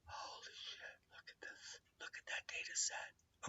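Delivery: hushed whisper over the data; awed whisper. Acted. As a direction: whisper as if it's too secret to say. Reading forecast in awe.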